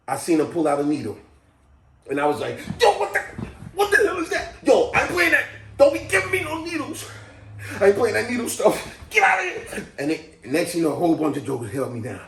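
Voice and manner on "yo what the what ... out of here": high, hollered mock panic; alarmed protest. Patient sees a needle pulled out, alarmed high protest. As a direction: yelp it out, pitched way up.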